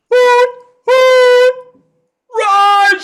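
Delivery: very high, loud call, drawn out; yelling for Raji. Acted. Character yells for his friend Raji, very high and loud. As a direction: shout across for your buddy. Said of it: "he's yelling for Raji".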